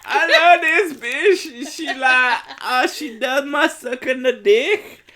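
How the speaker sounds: high, loud, laughing Kentucky drawl; hammed-up Kentucky twang, stretched out and loud; full Kentucky accent. Friend goofing about loving someone, full Kentucky accent. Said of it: "did your accent go full Kentucky".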